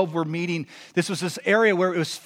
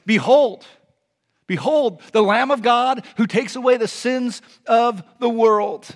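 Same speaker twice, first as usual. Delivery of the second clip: raised, higher heralding voice; John's proclamation; 5 semitones higher, 5 dB louder, slower than usual. Acted. John the Baptist identifying Jesus, loud proclamation. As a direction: announce it loudly, with slow, weighty phrasing.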